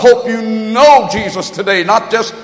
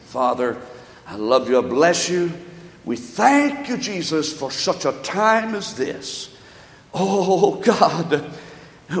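quiet prayer; 2 semitones lower, 5 dB quieter, slower than usual. Real, not acted. Pastor closing the sermon, praying quietly to God.

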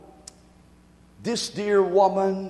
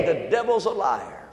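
ordinary talk

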